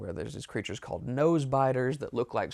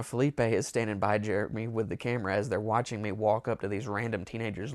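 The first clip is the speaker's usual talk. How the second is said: usual speaking voice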